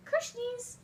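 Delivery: talking to a dog. Real, not acted. Pet name high.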